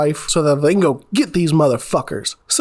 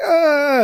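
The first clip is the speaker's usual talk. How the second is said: pained scream; 10 semitones higher than usual. Retold or imitated. Drain cleaner sprayed in eye.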